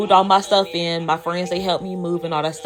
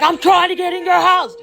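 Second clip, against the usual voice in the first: high, strained angry imitation; imitated angry stranger; mad lady; 11 semitones higher, 3 dB louder than usual. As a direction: snap it insistently, pitched way up. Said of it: "lady get mad at me".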